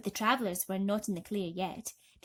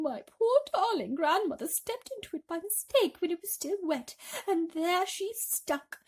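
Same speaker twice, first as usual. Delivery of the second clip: lightly voiced anxious spider; a hint of Miss Spider; 6 semitones higher than usual. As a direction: tell it with mild dread.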